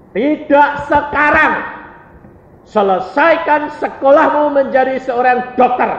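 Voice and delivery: high, emphatic preacher's voice of God; firm divine command. Retold or imitated.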